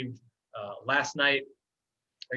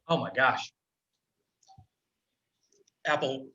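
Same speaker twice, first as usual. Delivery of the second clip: strained voice after choking; words squeezed out between coughs; 2 semitones higher than usual. Real, not acted. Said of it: "Went down the wrong pipe".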